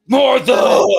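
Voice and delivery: anguished shout, high and loud. Acted. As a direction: scream the name in anguish. Batman v Superman quote shouted.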